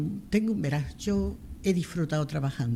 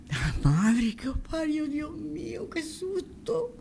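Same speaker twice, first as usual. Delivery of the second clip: shaken relief after a fright; 12 semitones higher, much slower than usual. Retold or imitated. María to laughing colleagues after prank, shaken high whimper. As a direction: let the scare out in a small, high, slow whimper.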